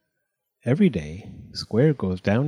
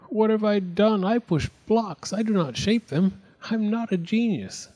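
despairing; 11 semitones higher than usual. A narrator's reading. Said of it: "He despaired".

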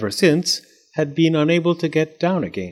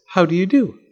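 high-pitched, polite doll voice; doll's polite greeting; 7 semitones higher than usual. A narrator's reading. Doll greets Fletcher from inside the egg, high polite voice.

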